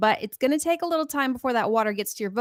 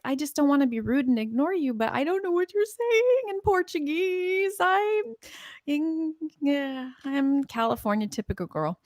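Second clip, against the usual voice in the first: sheepish apology; 6 semitones higher than usual. Retold or imitated. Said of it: "'cause I'm like".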